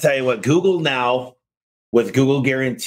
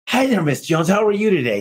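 bright, higher customer-service greeting; friendly phone voice; 8 semitones higher than usual. Retold or imitated. Tommy calling the customer back, a friendly higher phone voice. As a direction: sound delighted to hear from her. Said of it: "it's the tonality".

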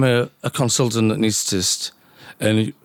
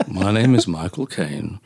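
lower-pitched Cockney film-star impression; Michael Caine; 4 semitones lower than usual. Doctor shows off his impressions, low Cockney actor voice. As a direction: do the famous London actor's voice, pitched down. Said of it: "And then I can do the".

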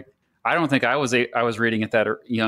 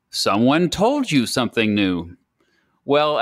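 ordinary talk, nothing marked

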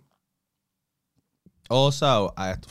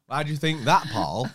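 mock course instructor; 4 semitones higher, 8 dB louder than usual. Voicing course instructor questioning him.

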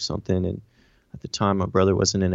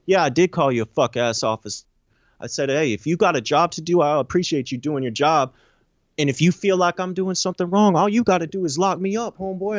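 retold back-talk, pitched up and insistent; defiant attitude; 7 semitones higher than usual. Inmate daring an officer to lock him up, defiant. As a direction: climb higher as you challenge him, without shouting. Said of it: "all that freaking attitude, all that".

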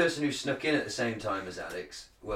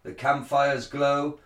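Shakespearean actor; 5 semitones higher than usual. Shown as a demonstration. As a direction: proclaim it like a druid priest. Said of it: "like a Shakespearean, um, uh, actor".

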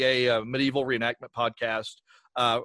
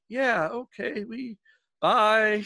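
small, high mock-awkward voice; meek backing off; 8 semitones higher, much slower than usual. Imitating online critic retreating from eager host, meek and high. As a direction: shrink away in a higher voice.

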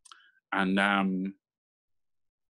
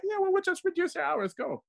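mock boss voice, much higher, dismissive; imitating the CEO. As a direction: wave them off with a shrug in the voice.

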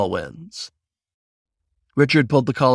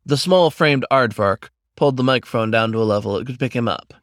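usual speaking voice

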